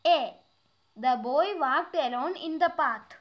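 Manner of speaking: slow, deliberate read-aloud of an English exercise; careful dictation pace; slow textbook reading. Acted. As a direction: read each word out clearly for the class. Reads exercise sentences slowly.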